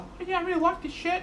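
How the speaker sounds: very high, excited mate's impression; high-pitched goofy mate voice; the Blythe voice. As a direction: squeak it out like a clueless, delighted bloke. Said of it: "The Blythe voice".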